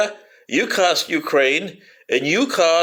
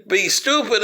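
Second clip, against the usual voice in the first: ordinary delivery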